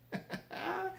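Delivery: short high burst of laughter; a quick real laugh. Real, not acted. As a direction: laugh at your own punchline. Laughs at own punchline.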